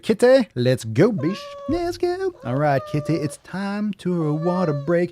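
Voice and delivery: high, drawn-out pet-calling voice; playful rallying. Pretend. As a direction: coax the kitty along in a squeaky singsong, slowly. Rallying the kitty for water break.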